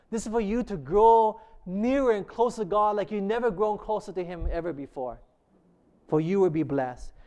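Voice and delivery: ordinary talk, nothing marked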